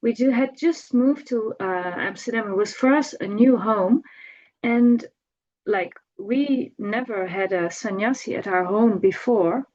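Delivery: ordinary delivery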